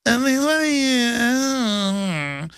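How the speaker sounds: high, stammering mock woman; high squeaky stammering woman. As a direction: play her high and flustered. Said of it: "She was like".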